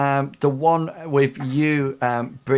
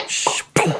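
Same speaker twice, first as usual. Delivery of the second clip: whooshed shh into a popped boom; voiceless whoosh and impact noise; imitated arrow shot. Game master acts out a bow shot, imitated whoosh and boom. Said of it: "You just go".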